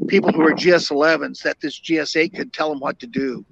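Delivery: usual speaking voice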